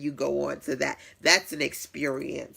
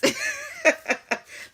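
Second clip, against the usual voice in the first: a high, bright burst of real laughter; 3 dB louder than usual. Real, not acted. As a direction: laugh out loud at her own joke. Laughs at own joke.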